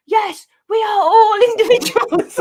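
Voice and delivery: high, loud crowd chant, slowed right down, laughing through it; a mock crowd chanting back in unison; the crowd chorusing their reply, hammed up and loud. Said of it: "they're all chorusing it".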